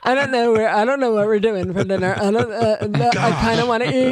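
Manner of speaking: mock dopey boyfriend drone; slack, mumbled words trailing into grunts; Napoleon Dynamite. Imitating her boyfriend's dinner talk, dopey mumbling drone. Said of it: "he's like Napoleon Dynamite".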